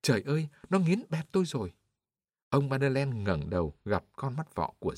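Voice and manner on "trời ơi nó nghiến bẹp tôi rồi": put-on high cry of an old man being crushed; pained cry. A narrator's reading. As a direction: cry out in pain, voice pitched high. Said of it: "Ông lão ở dưới xe kêu lên". (tiếng kêu đau đớn, giọng cao)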